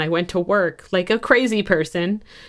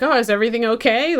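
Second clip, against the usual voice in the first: put-on nonchalant boyfriend; 7 semitones higher, 5 dB louder, faster than usual. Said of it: "nonchalantly playing video games or something".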